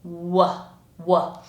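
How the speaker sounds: "W" made with rounded lips alone, no g; isolated "w" glide, tongue uninvolved; lips-only w. Shown as a demonstration. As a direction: say "w" with just a small lip movement. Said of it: "using just this little movement in my lips".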